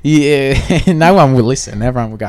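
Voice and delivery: ordinary delivery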